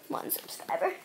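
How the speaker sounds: whispered aside; whispering. Real, not acted. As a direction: whisper it under your breath. Quick aside correcting subscriber count.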